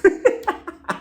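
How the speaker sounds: high laugh; amused laugh; real laugh. Real, not acted.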